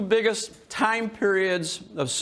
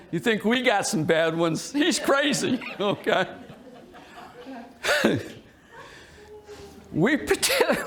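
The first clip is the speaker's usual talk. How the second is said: ordinary delivery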